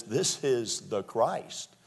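slow, weighty "This is the Christ."; preacher slowly declaring the claim; slow declaration. Retold or imitated. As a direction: let every word of "This is the Christ." land slowly. Quoting the people's claim.

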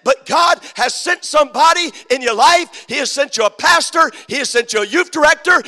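loud, high, driving preacher's call; full-force preaching. Acted. Pastor rousing the youth, in a loud preaching shout. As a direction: preach it to the rafters.

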